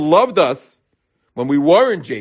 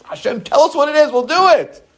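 put-on eager childlike promise; 12 semitones higher, 4 dB louder than usual. Eager childlike people answering God.

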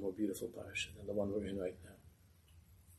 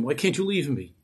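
louder, higher angry demand; screamed; 4 semitones higher, 8 dB louder than usual. Acted. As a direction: shout it at him in anger. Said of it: "the Rebbe screamed at him".

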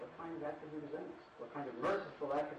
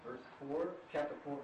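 ordinary talk, nothing marked

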